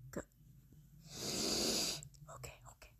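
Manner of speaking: nervous whisper to self. Real, not acted. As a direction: hush yourself before the reveal. Calming self before reveal.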